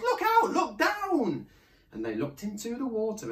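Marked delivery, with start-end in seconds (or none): 0.0-1.5 very high, loud, drawn-out cry; high shouted alarm; warning yell. A narrator's reading. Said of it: "who yelled".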